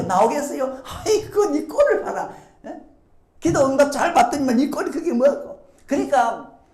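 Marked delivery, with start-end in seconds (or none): 0.8-5.7 jeering mocker.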